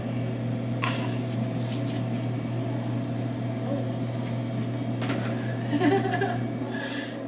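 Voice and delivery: helpless high giggle; real laughter; long breathy laugh. Real, not acted. Laughing after a painful test.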